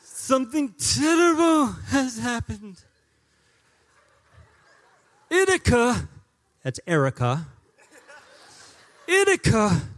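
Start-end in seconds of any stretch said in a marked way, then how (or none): 0.0-6.1 put-on high, loud, slow Spanish-accented pleading; Spanish accent impression. Sonny calls the pastor with terrible news, in a Spanish accent. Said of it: "my Spanish impersonation". 9.1-9.8 put-on high, loud Spanish-accented name; Spanish accent impression. Sonny on the phone names his wife, in a Spanish accent. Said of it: "my Spanish impersonation".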